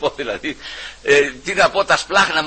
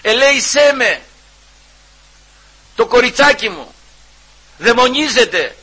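high, loud desperate plea; crying out; 8 semitones higher, 4 dB louder, slower than usual. Retold or imitated. Preacher voicing the mother calling after Christ, crying out. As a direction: cry out to the Lord for your child. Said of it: "και φώναζε".

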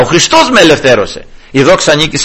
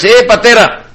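put-on calling an old man father; 6 semitones higher than usual. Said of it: "και πες".

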